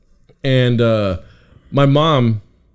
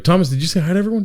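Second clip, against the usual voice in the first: nagging sibling; 8 semitones higher, much faster than usual. Retold or imitated. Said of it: "my little brother would be like".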